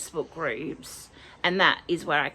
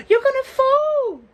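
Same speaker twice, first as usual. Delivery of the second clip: alarmed cry; 10 dB louder than usual. Real, not acted. Calls out to pet about to fall.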